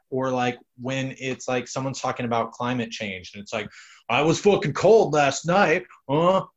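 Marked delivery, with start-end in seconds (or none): 4.1-6.5 mocking skeptic voice. Mocking a climate change skeptic.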